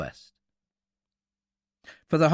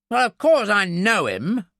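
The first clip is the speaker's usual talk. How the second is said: louder, higher, impatient retort; indignant snort; 8 semitones higher, 6 dB louder than usual. A narrator's reading. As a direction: snort it as if it's obvious. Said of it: "Briggs snorted".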